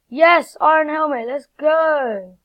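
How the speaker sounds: loud, high celebration; excited cheer. Real, not acted. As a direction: hype up the loot. Gets iron helmet.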